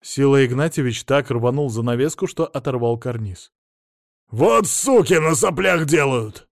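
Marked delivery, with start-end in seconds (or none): 4.3-6.5 loud, slow angry yell. A narrator's reading. Millionaire cursing the torn cornice, yelling. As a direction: bellow it, drawing out the words. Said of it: "заорал он".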